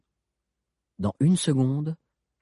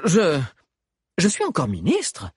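halting, tripping over the words; stammering; 6 semitones higher, 3 dB louder than usual. Acted. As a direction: stumble nervously on je. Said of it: "bafouilla Liu". (bégaiement hésitant)

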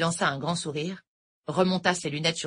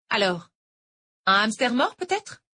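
lightly voiced older teacher; a hint of a sweet Irish tutor; sweet-talking teacher, lightly voiced; teacher Noël; 7 semitones higher, 5 dB louder than usual. A narrator's reading. Noël teasing.

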